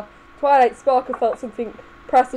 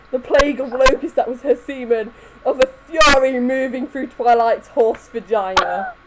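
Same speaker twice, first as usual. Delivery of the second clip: ordinary delivery, nothing marked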